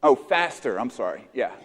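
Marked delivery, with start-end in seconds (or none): none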